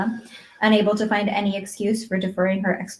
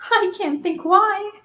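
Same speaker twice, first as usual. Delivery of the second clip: put-on high, overwhelmed girl's voice; dazed with joy, lingering on words; bewildered; 7 semitones higher than usual. A narrator's reading. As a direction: marvel in happy confusion, pitched up. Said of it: "in a tone of bewilderment".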